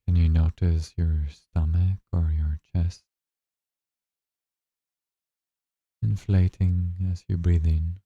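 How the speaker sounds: slow, calm meditation guide voice; guided meditation. Acted. Guide leading listeners through their first breathing meditation, slow and calm. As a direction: ease them into the breath, unhurried and gentle.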